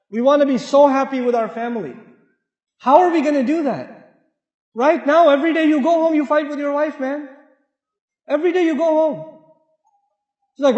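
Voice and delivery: normal talking voice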